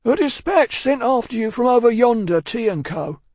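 high, loud call easing at the end; shouted message. Acted. Jerry delivering word to Lorry, shouted message. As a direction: holler the message across the distance.

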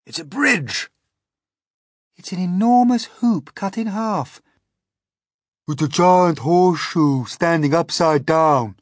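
high, loud guesses; loud, high exclamations; excited calling out. A narrator's reading. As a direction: call out each guess in amazement. Creatures call out guesses excitedly.

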